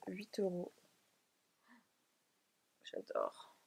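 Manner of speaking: unvoiced, intimate whisper. Real, not acted. Vlogger whispering the cardigan's price to herself. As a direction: murmur it to yourself with no voice.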